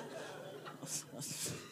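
a soft, airy laugh at her own joke; breathy laugh. Real, not acted. Preacher to congregation, soft airy laugh. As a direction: chuckle quietly under your breath.

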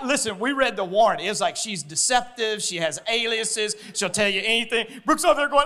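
ordinary talk, nothing marked

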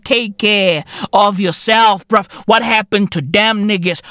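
normal talking voice

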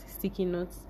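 ordinary delivery

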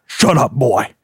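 put-on gruff old father's bark; barked order. A narrator's reading. Father silencing his kidnapped son, barked order. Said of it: "A U Rookman barked".

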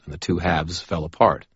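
ordinary delivery, nothing marked

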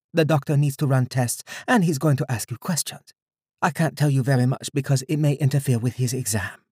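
concerned woman caring for patient. Acted.

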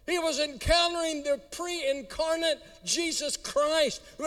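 high, far-reaching preaching; preaching at full force. Acted. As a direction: proclaim it high.

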